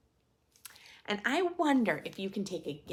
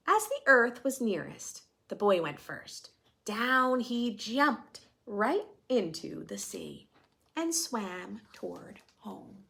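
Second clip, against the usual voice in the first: storytelling voice; 3 semitones higher, 4 dB louder than usual. A narrator's reading. Reading picture book to class.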